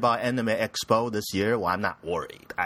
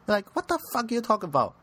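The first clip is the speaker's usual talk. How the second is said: usual speaking voice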